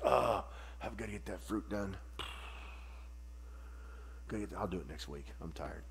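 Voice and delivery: low, soft, sleepy grumble of a tired tree; lazy tree. Retold or imitated. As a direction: mumble like a tree too lazy to bear fruit. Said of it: "a tree gets up in the spring and goes".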